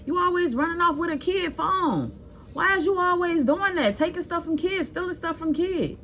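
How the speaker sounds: high-pitched retold yell down the street; hammed-up yell at the man. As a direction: shout after him as he runs off with the phone. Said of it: "While I'm yelling down the street".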